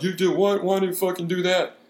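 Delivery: high, squeaky mock disbelief from the friends; a hammed-up voice for the big guy's shocked friends.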